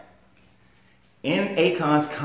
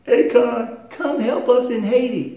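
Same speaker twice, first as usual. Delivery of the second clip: high-pitched whiny plea; mocking whine; 8 semitones higher, slower than usual. Scolding those who beg [name] for Haiti, high mocking whine. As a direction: whine it pitifully, mimicking a beggar. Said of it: "So don't sit whining".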